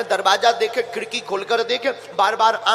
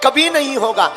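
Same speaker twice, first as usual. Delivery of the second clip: raised, ringing rhetorical questions to a crowd; rousing preaching; 4 semitones higher, 5 dB louder than usual. Real, not acted. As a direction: press the congregation for an answer. Preacher challenges the audience after story. (जोशीले प्रवचन में ललकारते हुए)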